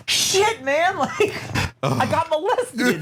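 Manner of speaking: high whiny dawning horror, laugh creeping in; mock dismay. Naive kid realizing what happened to him, hammed-up dismay. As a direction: stretch it out high, like a crushed kid.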